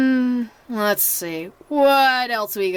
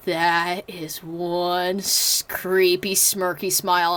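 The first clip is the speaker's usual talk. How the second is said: lower, slow, unsettled mock voice; creeped-out friends; 6 semitones lower, 3 dB quieter, slower than usual. Friends reacting to a creepy picture with lower, slow dread.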